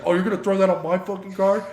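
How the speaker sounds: imitating the furious driver; mock outraged driver. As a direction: snap back at the threat. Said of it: "this guy goes".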